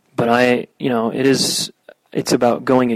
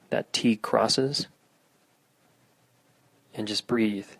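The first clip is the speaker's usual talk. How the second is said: guided meditation; 5 dB louder than usual. Acted. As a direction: guide listeners through grounding. Leads a meditation.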